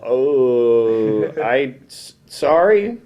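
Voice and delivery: halting mock apology rising into a question; awkward apology. Retold awkward reply to angry caller.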